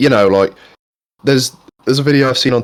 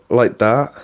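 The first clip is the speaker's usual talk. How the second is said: ordinary delivery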